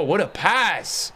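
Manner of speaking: slow, stretched admiring echo; drawn out awe. Real, not acted. Streamer savouring the pass for chat, drawn-out awe. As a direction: let it hang, in awe.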